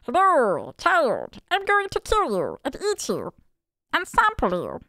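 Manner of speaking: high, silly Italian-style meatball voice; high hammed-up meatball voice. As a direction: ham up a goofy cartoon villain. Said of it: "the meatball voice".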